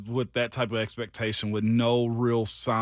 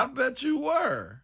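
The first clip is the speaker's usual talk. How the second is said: sarcastic; 11 semitones higher, slower than usual. Real, not acted. Sarcastic reply.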